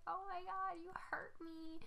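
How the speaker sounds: high, soft imitation of crying; mock crying. Her brother on the floor, high mock crying. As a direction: whimper it in a high, mocking little voice. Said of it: "was, like, crying".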